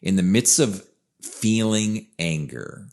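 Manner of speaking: ordinary talk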